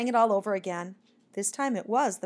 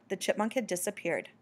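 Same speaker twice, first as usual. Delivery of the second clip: ordinary delivery, nothing marked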